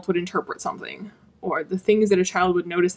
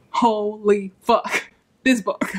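very slow, stunned swearing; reliving dumbfounded amazement; gobsmacked; much slower than usual. Retold or imitated. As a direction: let each word land separately in awe. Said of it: "I just was gobsmacked".

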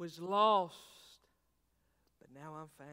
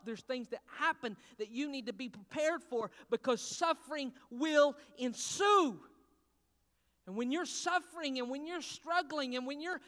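rising, louder preaching about suffering; building sermon; 8 semitones higher, 9 dB louder than usual. Acted. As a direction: climb toward a cry.

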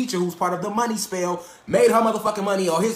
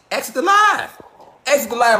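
much higher, louder call to leave; 6 semitones higher, 5 dB louder than usual. Real, not acted. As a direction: shout them off the stream. Ordering haters off the stream.

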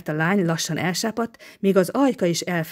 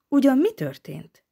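higher-pitched, apprehensive question; fearful foreboding; 7 semitones higher than usual. A narrator's reading. Girl learning something happened in the house, fearful foreboding. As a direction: ask with instinctive dread creeping in. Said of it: "hangjában ösztönös félelem és bal sejtelem bujkált".

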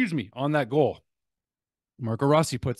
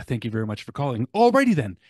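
Ace Ventura impression; 4 dB louder than usual. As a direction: do Jim Carrey's Ace Ventura, big finish. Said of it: "That's Ace Ventura".

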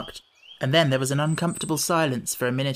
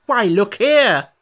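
louder, slower cry of why look here; cried out; 5 semitones higher, 8 dB louder, slower than usual. A narrator's reading. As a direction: call out to make her look. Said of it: "the Red Queen cried".

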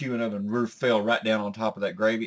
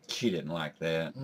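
deadpan; 5 semitones lower, 6 dB quieter, slower than usual. Acted. Running deadpan refrain.

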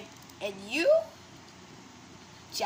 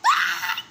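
high scream. Acted.

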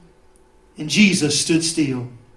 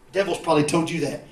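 normal talking voice

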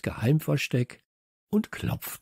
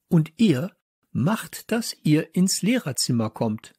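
angry order; 4 semitones higher, 3 dB louder than usual. Acted. Teacher ordering pupils to the staff room, angry. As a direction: send them off sternly.

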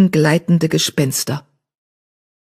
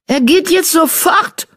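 much higher, slow hostile command; snarling order; 13 semitones higher, 3 dB louder than usual. A narrator's reading. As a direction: spit out the order. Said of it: "fauchte Mausefell". (fauchend, drohend)

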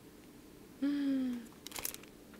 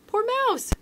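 very high pleading mouse voice; squeaky mouse; 6 dB louder than usual. Acted. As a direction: squeak a desperate plea. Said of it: "Mouse cried".

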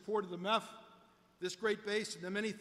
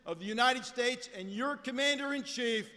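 formal announcer; 4 dB louder than usual. Real, not acted. Announcer introduces the President.

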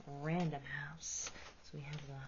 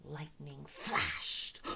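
plain reading voice

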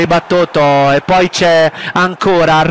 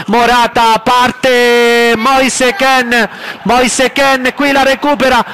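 breathless italian goal commentary; 5 semitones higher than usual. Acted. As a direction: pitch climbing, calling every pass. Live play-by-play building.

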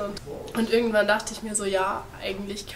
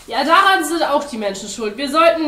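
high, loud accusation; worked-up, raised voice; agitated blaming; 7 semitones higher, 11 dB louder than usual. A narrator's reading. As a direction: launch into a heated rant. Penguin starts war rant again.

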